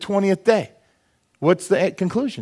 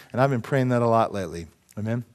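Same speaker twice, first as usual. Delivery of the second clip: normal talking voice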